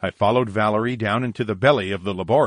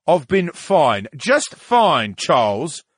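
slow, raised, sulky character voice; offended, higher-pitched grousing; grumbling; 7 semitones higher, 6 dB louder, slower than usual. Acted. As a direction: grumble loudly with drawn-out words. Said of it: "the brain grumbled".

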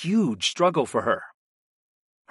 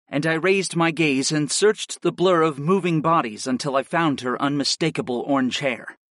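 usual speaking voice

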